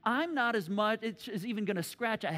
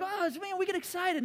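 high, excited exclaim; pitched-up eager churchgoer; 10 semitones higher than usual. Retold or imitated. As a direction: gush with excitement a little. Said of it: "We're like".

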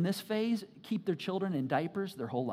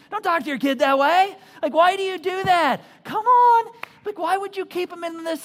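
higher and louder, fed up with the coddling; exasperated rebuke; 8 semitones higher, 9 dB louder than usual. Real, not acted. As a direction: scold it, rising to a loud come on.

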